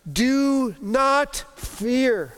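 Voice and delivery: calm a frightened man, drawn out; gentle reassurance. Acted. King comforts trembling cripple.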